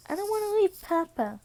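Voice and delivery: put-on high pleading little kid voice; little girl voice. Eri begging not to leave Papa, high little-kid plea. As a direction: pitch it up like a small child begging to stay.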